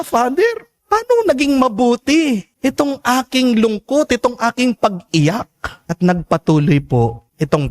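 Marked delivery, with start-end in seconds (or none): 0.0-5.5 high, rising, bewildered question; puzzled father asking. Retold or imitated. Father questions the priest in high disbelief. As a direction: ask in disbelief why sorrow is good. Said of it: "At nagtanong siya".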